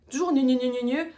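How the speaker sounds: loud nonsense imitation of nagging; mocking squawky nagging. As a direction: mimic his pestering with contempt. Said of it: "ça me piaille dans mes oreilles".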